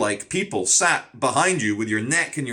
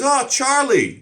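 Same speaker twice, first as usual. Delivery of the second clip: high, louder imitation of a delighted lady; excited greeting; 11 semitones higher, 4 dB louder, slower than usual. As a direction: call out in greeting, pitched well up. Retold lady's greeting on the bus.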